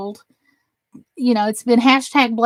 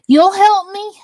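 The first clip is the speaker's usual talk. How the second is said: excited; 9 semitones higher, 5 dB louder than usual. Real, not acted. Delighted reaction to a chat offer.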